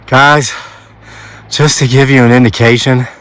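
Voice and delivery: ordinary talk, nothing marked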